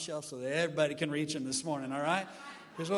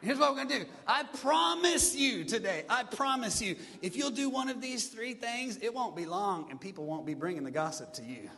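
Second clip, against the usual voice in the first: excited preaching; 4 semitones higher, 6 dB louder than usual. Real, not acted. Excited preaching after demo.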